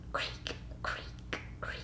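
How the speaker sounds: creak, creak whispered; hushed spooky creak, creak. Pretend. Imitating creaking sounds in story.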